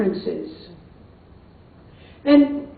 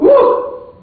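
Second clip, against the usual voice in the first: a high, louder whoop as the ride lifts; mock whoop; 13 semitones higher, 6 dB louder than usual. Maria as the Ferris wheel goes up, a high whoop. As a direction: let out a thrilled woo. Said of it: "she said".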